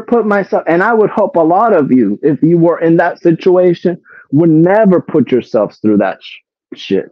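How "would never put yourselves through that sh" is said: ordinary delivery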